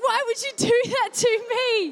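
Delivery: high, loud frightened protest; scared outcry. Retold or imitated. The man joked about abduction, she protests frightened. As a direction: cry out after the scare. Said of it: "I was honestly so scared".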